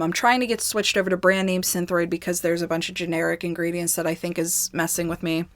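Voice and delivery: ordinary talk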